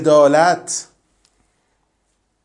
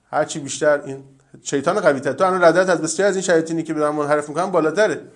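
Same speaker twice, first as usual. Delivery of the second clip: ordinary talk, nothing marked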